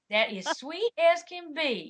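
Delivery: a lightly put-on Southern accent. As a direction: drawl the line sweetly in a Southern accent. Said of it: "we chose a line that she said".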